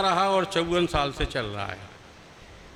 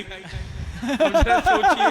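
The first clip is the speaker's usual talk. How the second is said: 1 laughing; 9 semitones higher, 4 dB louder, much slower than usual. Real, not acted. Laughing rhetorical appeal to audience.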